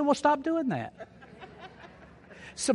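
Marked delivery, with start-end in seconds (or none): 0.0-0.9 higher, laughing through the line; grandmother's quip. Retold or imitated. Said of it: "She said".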